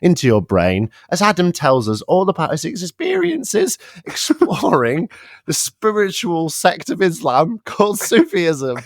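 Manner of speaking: mock guided meditation. Co-host guiding the listeners back into the story, mock meditation voice. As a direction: play the meditation guide, stretching the phrases.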